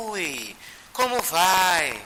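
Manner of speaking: long, drawn-out kindly hello; Chico's greeting. Retold or imitated. Chico greeting a visiting spirit, drawn-out greeting. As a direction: stretch the greeting out slowly. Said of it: "E aí o Chico".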